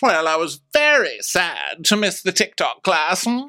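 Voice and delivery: high, grandly theatrical lady; Karen. Retold or imitated. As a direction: declare it up high, stretching the final words. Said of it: "And she tells us".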